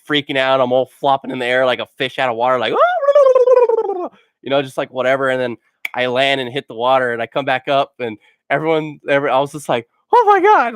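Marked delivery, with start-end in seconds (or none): 2.8-4.1 a high, squeaky squeal of a laugh. Retold or imitated. Flopping in air like fish. 10.1-10.7 much higher and louder, stunned; shocked exclaim. Retold or imitated. Stunned after being launched airborne.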